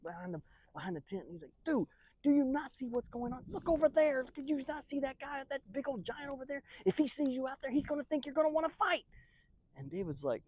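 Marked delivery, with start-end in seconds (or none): 1.6-9.0 high, strained frantic warning; hammed-up alarm, pitch way up; panicked warning. Soldier warns David about the giant, panicked.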